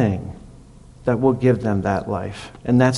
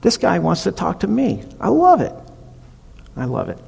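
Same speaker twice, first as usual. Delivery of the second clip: much higher, thrilled voice; excited; 6 semitones higher than usual. Retold or imitated. Zacchaeus thrilled that Jesus wants to visit, much higher excited voice. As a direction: burst out with high delight. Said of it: "He was totally excited".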